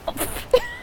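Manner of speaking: short high giggle; a quick burst of laughter. Real, not acted. Breaking into a giggle while taping a goodbye.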